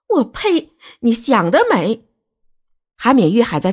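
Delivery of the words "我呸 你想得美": high-pitched, spitting contempt; scornful rejection. Acted. Angry parent rebuffing the in-law's pleading, scornful. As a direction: spit out the refusal, pitch leaping up.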